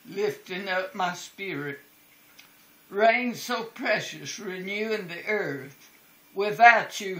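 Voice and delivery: ordinary delivery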